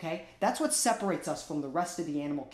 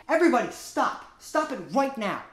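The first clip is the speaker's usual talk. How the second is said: shout; 6 semitones higher, 7 dB louder than usual. Acted. Shouting to stop the fight.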